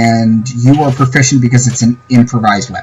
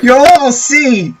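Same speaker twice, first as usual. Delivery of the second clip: high maniacal villain laugh; slow, gloating threat breaking into cackling; wild unhinged ranting; 14 semitones higher than usual. Acted. Wizard's parting threat as he flees.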